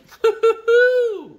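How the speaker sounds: loud "hoo-hoo-hoo" ending in a long hoot; high whooping laugh. Real, not acted. Streamer reacting to Alicia's message, high drawn-out whoop. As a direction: whoop it high, last note held.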